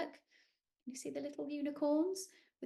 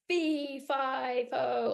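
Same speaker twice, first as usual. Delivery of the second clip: giant's shout; 3 semitones higher, 5 dB louder, much slower than usual. Acted. Giant voice repeated.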